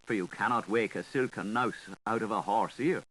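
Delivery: plain reading voice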